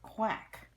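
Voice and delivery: high, loud duck quack; playful loud duck call. Pretend. Mother imitates duck for child.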